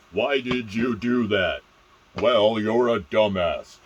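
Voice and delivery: loud, drawn-out mocking quote; sarcastic quote. Shown as a demonstration. Quoting somebody and reacting to it, loud sarcasm. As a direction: overplay it loudly and slowly. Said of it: "You don't want them using the same voice".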